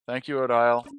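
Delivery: ordinary talk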